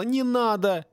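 high, groaned plea; acted plaintive groan; moaning. A narrator's reading. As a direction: moan it out in protest. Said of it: "простонал Донателло".

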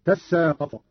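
"تساقط" as tassaaqat, s doubled; geminated s in "تساقط"; merged into sin. Shown as a demonstration. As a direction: hold the s of "تساقط" after a short ta. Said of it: "وأدغمت في السين فصارت هكذا".

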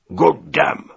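loud, angry curse; furious exclamation. A narrator's reading. Commodore cursing the stubborn delegate, furious. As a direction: burst out in anger, punching both words. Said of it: "zawołał czerwony z wściekłości".

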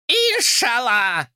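high, slow, creaky parrot squawk. Acted. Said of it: "отчётливо проскрипела птица".

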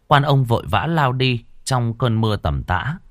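ordinary delivery

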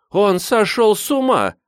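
high-pitched exclamation of horror; scandalised, high cry; appalled outcry. A narrator's reading. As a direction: wail it high in disbelief. Mother clutches her head, appalled.

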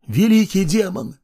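high, pleading worship; fearful praying. Acted. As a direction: cry out in terror. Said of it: "молились они".

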